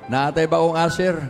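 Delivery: ordinary talk, nothing marked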